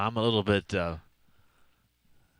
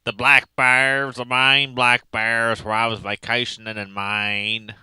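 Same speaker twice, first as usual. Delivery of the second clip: W Lynn. Acted. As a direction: drag out each team word in a put-on voice. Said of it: "in W Lynn's voice".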